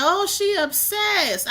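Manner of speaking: high, drawn-out mimicry; mocking critics. Streamer quotes critics' accusation to her viewers, mocking.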